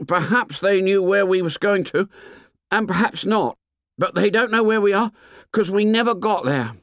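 high, put-on boy's voice; anxious Piggy. A narrator's reading. Pale Piggy tells the boys they are lost, high and anxious. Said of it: "and breathless".

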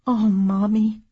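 usual speaking voice

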